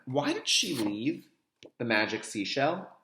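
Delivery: normal talking voice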